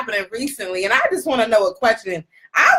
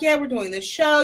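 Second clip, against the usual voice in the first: usual speaking voice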